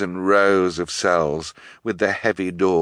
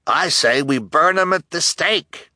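high, raised rallying call; outraged townsman, pitched up; angry townsman's cry; 10 semitones higher, 4 dB louder than usual. A narrator's reading. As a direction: cry it out to rouse a mob. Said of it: "cried Mister Lesko".

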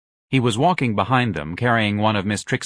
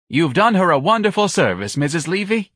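higher, louder false cheer; false politeness; 8 semitones higher, 4 dB louder than usual. A narrator's reading. Office manager faking thanks to the matron. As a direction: fake your gratitude. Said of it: "His voice was false".

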